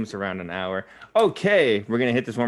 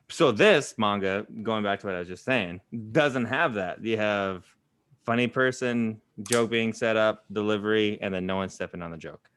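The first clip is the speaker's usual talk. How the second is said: ordinary delivery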